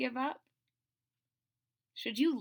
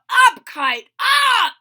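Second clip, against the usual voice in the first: much louder, high cheering shout; 12 semitones higher, 15 dB louder, much slower than usual. Acted. Frog showing Toad how to call the kite up, loud shout. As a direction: yell it up at the kite. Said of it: "jump up and down and shout".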